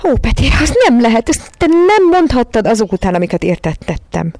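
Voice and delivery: high, pleading voice near tears; almost crying. A narrator's reading. Miss Lark pleading with Peti, almost in tears. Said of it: "már szinte sírva fakadt".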